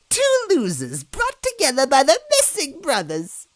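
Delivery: high sneering witch voice; mocking witch. A narrator's reading. Villain mocking two losers, high sneering voice. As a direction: jeer at them.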